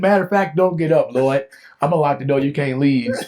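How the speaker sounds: loud, high, insistent comic orders; mock bossy host. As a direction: order the guest about in playful panic. Acting out bossing God as houseguest.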